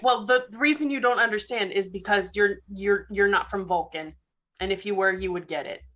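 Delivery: low, flat, emotionless delivery; deadpan alien with no feeling; stoic Vulcan. Acted. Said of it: "Totally stoic, no emotion whatsoever".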